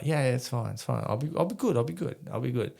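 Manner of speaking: imitating a tipsy brush-off; placating drunk protest. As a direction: wave off concern, insisting lightly. Drunk, assuring brother he's fine.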